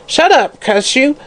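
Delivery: high, vicious hag's yell. Acted. Hag yelling at the sick singer, high and vicious. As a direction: bark her down like a crone. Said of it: "yelled Mother Guttersnipe viciously".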